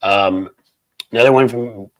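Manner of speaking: usual speaking voice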